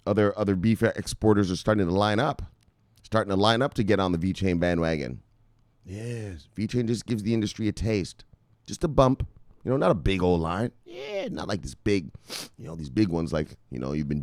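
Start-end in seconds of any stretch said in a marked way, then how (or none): none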